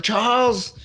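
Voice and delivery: mock yell. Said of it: "And she's like".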